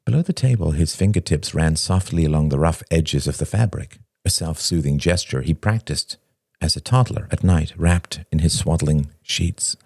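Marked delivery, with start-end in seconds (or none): none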